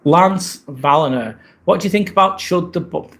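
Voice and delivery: ordinary delivery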